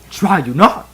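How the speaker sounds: a hint of old Jedi master; playful mock Yoda taunt; fake Yoda voice. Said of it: "using my fake Yoda voice again".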